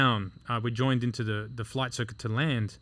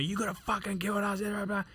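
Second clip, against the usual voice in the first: high, held-back imitation of screaming; mock yelling; 7 semitones higher, 7 dB quieter than usual. Instructor yelling at trainee after rough landing, mock yell. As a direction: imitate an angry rant at reduced volume. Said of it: "He's fucking yelling and screaming at me".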